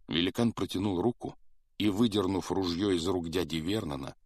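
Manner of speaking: ordinary delivery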